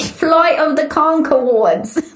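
high, drawn-out mock Kiwi; Kiwi accent. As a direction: put on a slow, high New Zealand accent. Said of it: "That's my, um, Kiwi accent".

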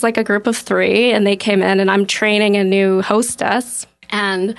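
normal talking voice

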